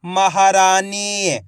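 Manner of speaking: महारानी slow with long raa and nee; blended word demo. Shown as a demonstration. Teacher reading for pupils to repeat, महारानी drawn out. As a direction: stretch महारानी syllable by syllable. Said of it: "आपको मेरे साथ साथ repeat करना है".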